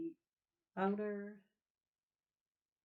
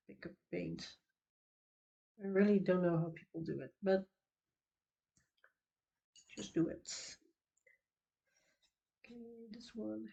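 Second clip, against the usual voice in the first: breathing hard through a blocked nose; audible breath with a stuffy nose; heavy breathing; 3 dB quieter than usual. Real, not acted. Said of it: "Sorry for the heavy breathing, my nose isn't working".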